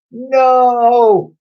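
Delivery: very high, very loud cry; cried. A narrator's reading. Twins crying out at the slime's plan. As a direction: cry out in despair. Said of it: "cried the pair".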